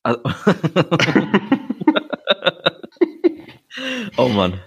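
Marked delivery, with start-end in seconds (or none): 0.3-3.3 helpless high giggle fit; high burst of laughter. Real, not acted. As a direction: cracks up completely. Breaks into laughter.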